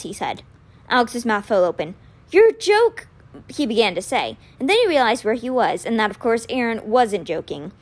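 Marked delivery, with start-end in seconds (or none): 2.3-3.1 indignant outburst. Acted. Alex blurts indignantly.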